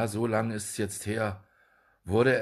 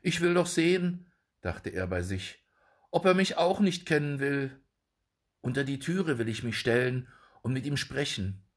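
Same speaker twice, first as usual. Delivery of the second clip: high, eager little dwarf; 6 semitones higher than usual. A narrator's reading. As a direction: voice the small Jakob up high. Jakob's thoughts in high voice. (hohe Zwergenstimme)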